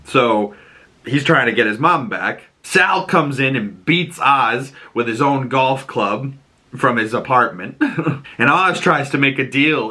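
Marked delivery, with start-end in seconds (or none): none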